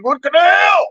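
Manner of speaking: high, loud comic shout; incredulous outburst. Acted. Comic baffled by the man's toy confusion, incredulous yell. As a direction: burst out in hammed-up disbelief.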